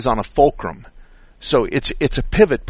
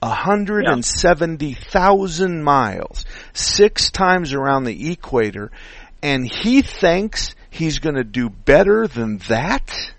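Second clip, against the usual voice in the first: slow, emphatic disbelief; 4 semitones higher, slower than usual. Real, not acted. Mechanic scoffs at the shop's advice.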